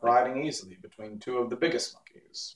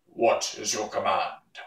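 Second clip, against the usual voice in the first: monkey king voice; 3 semitones lower, slower than usual. A narrator's reading. Monkey king addresses Dorothy.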